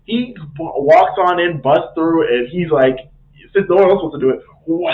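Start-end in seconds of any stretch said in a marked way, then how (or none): 4.7-4.9 long airy whoosh noise; imitated whoosh. Sindri busts through to break it, imitated whoosh. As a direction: voice the rush of a swing with breath.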